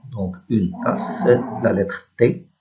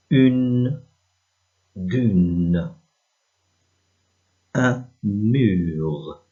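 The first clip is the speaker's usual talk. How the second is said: slow reading model; 3 semitones higher, 3 dB louder, much slower than usual. Shown as a demonstration. Teacher reads the words under the images, slow and deliberate. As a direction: read each word slowly and separately for learners.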